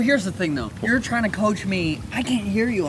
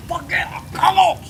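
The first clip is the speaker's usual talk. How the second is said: loud triumphant cheer; excited; 12 semitones higher, 4 dB louder than usual. Real, not acted. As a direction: celebrate loudly. Triumphant after underwater.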